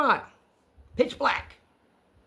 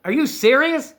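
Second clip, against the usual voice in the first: high, loud incredulous question; retold disbelief; 8 semitones higher, 6 dB louder than usual. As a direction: re-enact being exasperated and incredulous. Retold disbelief at the old man.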